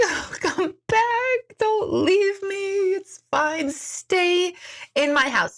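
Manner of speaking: hammed-up clingy begging, stretched out; mock pleading. Mom begging the family not to leave, mock whimpering plea.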